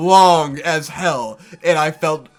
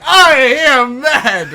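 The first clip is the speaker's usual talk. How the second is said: loud, high, drawn-out mock rage; jokey fury; 7 semitones higher, 9 dB louder, slower than usual. Volunteer jokingly raging at the director, mock fury. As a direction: stretch each word into a hammed-up yell. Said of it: "it was all kinda jokey".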